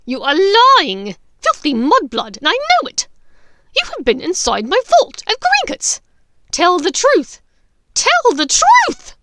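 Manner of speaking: furious accusation. Acted. Bellatrix screaming at Hermione to tell the truth. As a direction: tear into her.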